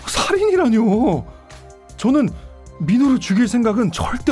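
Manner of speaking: aggrieved, emphatic protest; indignant protest. Acted. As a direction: plead your innocence forcefully. Suspect protests murder accusation in interrogation.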